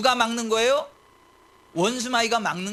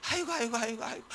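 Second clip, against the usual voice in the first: soft, helpless run of sighing words; feeble, hushed lament; mock pastor's moaning; 9 dB quieter, faster than usual. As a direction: moan along with the flock. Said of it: "목사까지".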